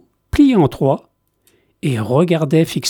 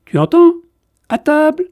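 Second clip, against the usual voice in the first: put-on high, small child's voice calling to dinner; tiny girl summoning the penguin to eat; small girl telling the penguin it's dinnertime; little girl's voice; 12 semitones higher, 4 dB louder than usual. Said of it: "dit la fillette de sa petite voix".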